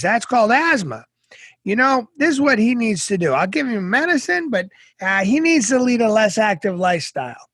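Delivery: caricatured doctor prescribing chess. Retold or imitated. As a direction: ham up the know-it-all doctor. Doctor explains diagnosis.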